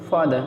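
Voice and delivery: "फादर" ending in "aa" with no R; र silent. Shown as a demonstration. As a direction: say "फादर" as faa-daa. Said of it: "केवल आ बोलते हैं". ("फादर" का आखिरी र छोड़कर)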